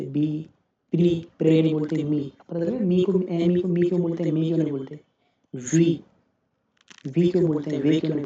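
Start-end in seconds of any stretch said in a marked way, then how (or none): none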